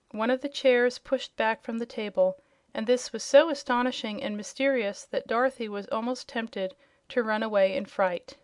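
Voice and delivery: ordinary delivery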